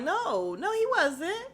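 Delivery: high, giggling "no, no"; laughing denial. Retold or imitated. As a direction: scoff through a laugh. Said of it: "I'm like".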